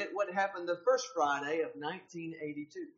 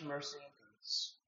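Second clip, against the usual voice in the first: normal talking voice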